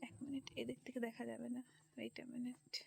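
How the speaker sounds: hushed whisper, barely voiced. Real, not acted. Vlogger at a quiet ashram whispering to herself while filming. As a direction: whisper so the crowd nearby can't hear. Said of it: "আস্তে আস্তে কথা বলছি".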